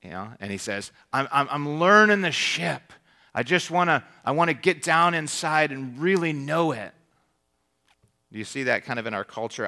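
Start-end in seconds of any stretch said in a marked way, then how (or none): 1.1-6.9 lightly voiced lieutenant, higher and louder; earnest lieutenant. Lieutenant answers yelling captain from the hull, lightly acted raised voice. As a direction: call back up, insisting on each phrase.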